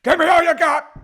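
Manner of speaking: sudden high, loud movie-star shout; Al Pacino. Acted. Host launches toilet news with a shouted Al Pacino impression. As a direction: yell it like an over-the-top screen gangster. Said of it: "The Al Pacino's popped up again".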